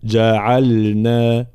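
very slow جعلنا, ل fully sounded; clear silent lam. Shown as a demonstration. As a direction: rest on the unvowelled ل in جعلنا before نا. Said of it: "واحرص على السكون في جعلنا". («جعلنا» بإظهار سكون اللام)

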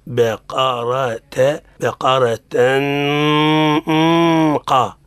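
"بقرة" slow, nasal starting light and thickening into قا; gradual ghunna. Shown as a demonstration. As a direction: stretch "بقرة", easing the long hum gradually into a heavy "قا". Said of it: "نحن نقول".

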